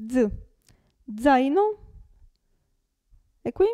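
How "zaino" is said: Zaino begun with a voiced dz; voiced initial z. Shown as a demonstration. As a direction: start Zaino with a buzzing dz. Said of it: "all'inizio della parola si pronuncia z". (Zaino con la z iniziale sonora, dz)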